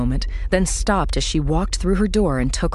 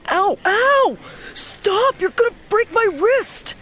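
pained shout. A narrator's reading. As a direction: yelp it out in pain. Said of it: "he shouted".

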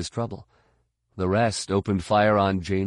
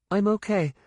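put-on high young woman's character voice; Mirta; 12 semitones higher than usual. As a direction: reassure in a high, strained pitch. Injured Mirta reassures Fett.

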